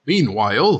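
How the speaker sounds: normal talking voice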